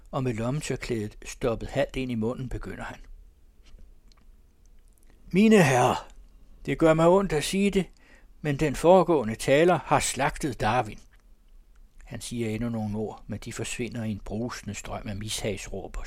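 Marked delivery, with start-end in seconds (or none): 5.3-11.0 higher, louder public address; raised, declaiming speaker's voice; loud oration. A narrator's reading. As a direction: address a noisy hall. Speaker addresses a rowdy assembly.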